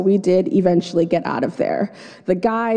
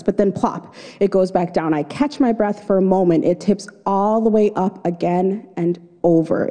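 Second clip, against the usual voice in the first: ordinary talk, nothing marked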